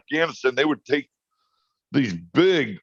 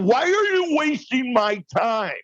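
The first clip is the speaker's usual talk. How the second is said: mock-exasperated husband, much higher; exasperated outburst; 11 semitones higher than usual. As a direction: throw up your hands, done with the dithering. Jim's exasperated outburst at wife.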